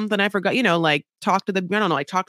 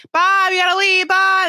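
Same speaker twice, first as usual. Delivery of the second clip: breezy mock goodbye; 10 semitones higher, 5 dB louder than usual. Mocking parents leaving kids.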